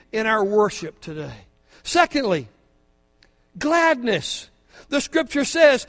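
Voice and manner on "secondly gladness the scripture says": ordinary talk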